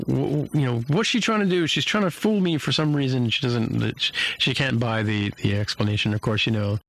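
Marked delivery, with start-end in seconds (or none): 0.1-3.3 stammered then much higher, distrustful; suspicious captain. Retold or imitated. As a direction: she smells a trick. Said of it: "she's like".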